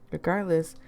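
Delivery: ordinary delivery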